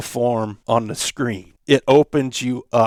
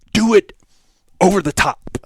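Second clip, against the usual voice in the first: louder, higher push on do it; over the top; 8 semitones higher, 5 dB louder than usual. Retold or imitated. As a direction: punch it out big. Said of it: "in his unique manner, goes".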